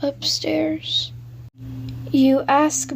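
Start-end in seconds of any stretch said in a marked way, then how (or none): 0.0-1.1 drawn-out whispered warning, rising higher; slow ghostly whisper. Acted.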